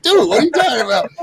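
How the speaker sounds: high, loud, fast disbelief; incredulous retort. Retold or imitated. Streamer retelling his reply to the apologizing troll, incredulous. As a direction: fire it back in disbelief. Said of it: "I'm like".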